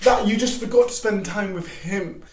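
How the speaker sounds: normal talking voice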